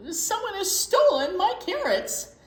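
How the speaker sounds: upset rabbit. Acted. Rabbit tells Mole his carrots were stolen, high upset cry. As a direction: let Rabbit wail about the theft.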